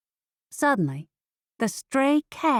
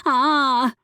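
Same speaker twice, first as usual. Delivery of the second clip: loud, high cat scream; cat's scream; 6 semitones higher, 7 dB louder than usual. A narrator's reading. Cat screams back at the driver, loud scream. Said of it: "The cat screamed in my head".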